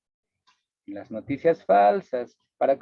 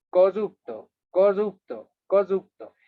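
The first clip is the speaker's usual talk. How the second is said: corrupto, corrupto, corrupto, each one pitched up and pushed louder; hammering repetition; 4 semitones higher, 4 dB louder than usual. Shown as a demonstration. Teacher imitating politicians repeating an insult, hammering. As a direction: drive the word home like a slogan. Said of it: "Utilizar una palabra a tal punto y repetir".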